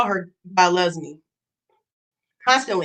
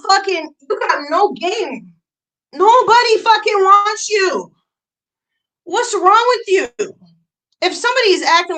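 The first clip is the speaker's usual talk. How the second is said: exasperated yell; 8 semitones higher, 4 dB louder than usual. Real, not acted. Berating rival.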